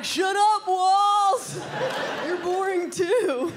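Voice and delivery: high, loud, laughing yell, then slow at the end; mock shout at walls. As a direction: snap back at the walls in a hammed-up yell. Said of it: "I'd be like".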